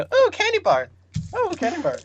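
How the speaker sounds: high, dopey "ooh" at a candy bar; distracted teammate voice. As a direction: play the easily distracted teammate. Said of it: "and I'll just sit there and go".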